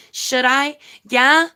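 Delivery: high, half-hearted mock of a needy woman asking permission; mimicking a rival begging her subscribers for a yes. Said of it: "I can't even do her fucking voice".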